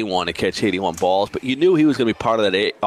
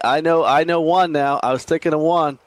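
excited; 8 semitones higher, 4 dB louder than usual. Real, not acted. Suddenly remembers a tight end.

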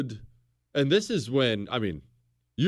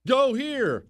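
bossy demand; 8 semitones higher, 3 dB louder than usual. Acted. French ordering the British.

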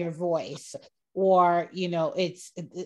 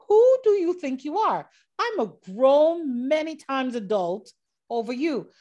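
high, loud mock outrage; indignant; 6 semitones higher, 3 dB louder than usual. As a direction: put him in his place, hammed-up. Retells urge to slap him.

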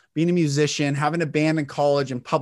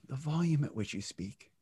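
hushed, much softer delivery; very low volume; 12 dB quieter than usual. Shown as a demonstration. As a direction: turn the volume right down.

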